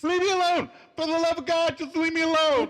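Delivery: hammed-up begging. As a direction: beg in a panicked falsetto. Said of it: "And Chandler begs".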